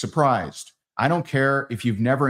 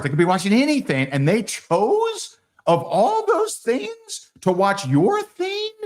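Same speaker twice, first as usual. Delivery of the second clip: mock amazement; 9 semitones higher than usual. Marveling at prospect's choice.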